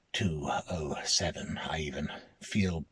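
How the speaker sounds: usual speaking voice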